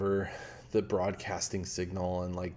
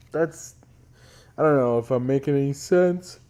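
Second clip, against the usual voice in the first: yawning; 6 semitones higher, 10 dB louder than usual. Real, not acted. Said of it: "I'm yawning on the stream".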